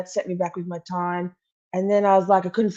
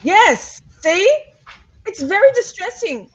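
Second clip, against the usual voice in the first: high, loud, emphatic squeal; squeaky, worked-up agreement; distressed squeal; 13 semitones higher, 7 dB louder, slower than usual. Real, not acted. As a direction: pitch it way up in exaggerated distress. Agreeing emphatically about lost vapes.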